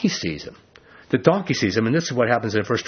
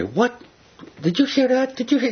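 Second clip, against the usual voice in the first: shrill, stunned squeak much higher; flabbergasted; 12 semitones higher than usual. Retold or imitated. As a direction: gasp it out in pure disbelief. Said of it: "he would just say".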